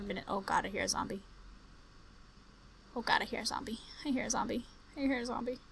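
hushed frightened whisper; real fearful whisper; scared whisper. Real, not acted. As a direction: whisper so the zombie can't hear. Whispering in fear of zombie.

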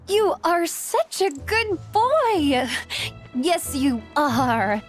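high, slow, fawning coo; cooing baby talk. Acted. Girl praising the horse as a good boy, cooing baby talk. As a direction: coo to a pet, stretching the words.

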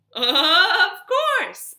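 high, loud happy reply; delighted. A narrator's reading. Horse answering the overjoyed cowboy, excited and loud. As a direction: answer big and high, with gusto.

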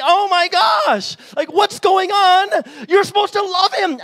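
exasperated outcry. Pretend. Viewer protests at the movie, loud and exasperated. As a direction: protest it in disbelief.

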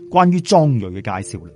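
ordinary delivery

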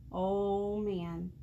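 usual speaking voice

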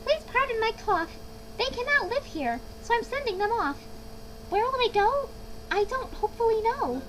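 high, querulous creature voice; Lorax's complaining voice. A narrator's reading. Lorax sending his swans away from smog, querulous. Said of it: "said the Lorax".

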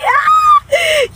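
sudden high shrieking laugh; a startled cry that turns into a laugh; yelp-laugh. Real, not acted. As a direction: burst out in a loud squealing laugh. Pretend punch at camera.

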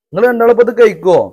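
fast, loud, high call across the yard. Real, not acted. Calling out to the kids. (കുട്ടികളെ ഉറക്കെ വിളിച്ച് വേഗത്തിൽ ചോദിക്കുന്നു)

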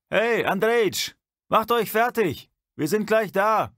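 high, loud call across the dark tunnel; a guard hailing the campfire from the dark, loud and high; calling out from afar. Acted. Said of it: "rief ihnen jemand von Süden aus dem Dunkel zu".

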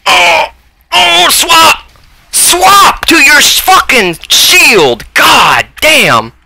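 high, loud panicked yell; shouting instructions mid-fight; real shout. Real, not acted. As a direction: scream the order at a teammate. Yelling at ally to swap shield.

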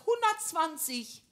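mock proud, stretch the number out slowly. Wife imitating her husband's proud answer, mildly puffed-up and slow. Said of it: "Da sagt er ganz stolz".

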